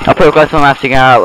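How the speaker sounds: ordinary delivery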